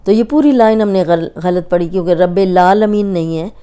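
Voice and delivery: normal talking voice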